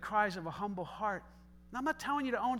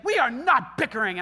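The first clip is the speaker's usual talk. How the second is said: louder defensive snap; a touch of testy denial; bickering tone; 4 semitones higher, 11 dB louder than usual. Retold or imitated. Husband denying bickering to daughter, defensive. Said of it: "in a very bickering tone".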